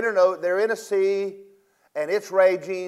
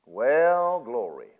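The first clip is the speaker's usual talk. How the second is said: unbothered believer; 5 semitones lower, much slower than usual. Retold or imitated. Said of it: "you can look at circumstances and go".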